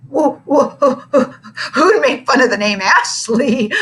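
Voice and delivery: sputtering with a nervous laugh. A narrator's reading. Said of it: "sputtered Bruce".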